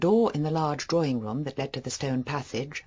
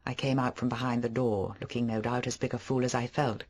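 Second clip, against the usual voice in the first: usual speaking voice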